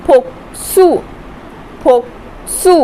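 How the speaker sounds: फोक्सु with a clear s after k; pronunciation demo. Shown as a demonstration. Teacher modelling the word, careful. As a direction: say फोक्सु with k then s. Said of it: "consonant और vowel के बीच में".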